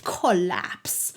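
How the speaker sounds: cartoony Disney-style accent, drawn out; lightly hammed-up cartoon accent; put-on accent. Shown as a demonstration. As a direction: play up a cartoonish accent on collapse. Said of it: "I wanted to say that in an accent".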